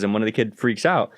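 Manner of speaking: ordinary talk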